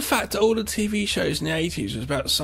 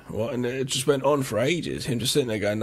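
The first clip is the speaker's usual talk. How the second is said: ordinary delivery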